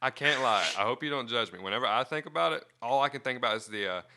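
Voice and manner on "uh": ordinary talk, nothing marked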